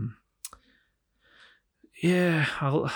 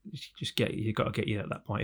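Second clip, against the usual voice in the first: ordinary talk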